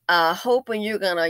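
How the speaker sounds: usual speaking voice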